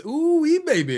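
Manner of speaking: put-on "Ooh-wee" squealed high and loud, "baby" falling; uncle's hype greeting. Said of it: "he was always".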